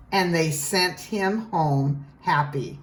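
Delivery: usual speaking voice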